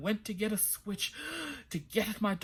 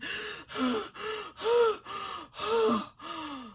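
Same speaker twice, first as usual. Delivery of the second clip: high shocked gasp; a long startled intake of breath; sharp gasp; 12 semitones higher than usual. Acted. King's shocked reaction.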